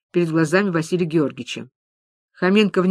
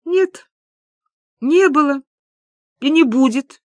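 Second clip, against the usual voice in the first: high, halting finality; emphatic confirming; 7 semitones higher, 4 dB louder than usual. Acted. Люба confirms there is no bank, emphatic. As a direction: pitch each phrase up, with heavy pauses. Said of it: "подтвердила Люба".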